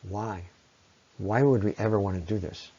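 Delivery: usual speaking voice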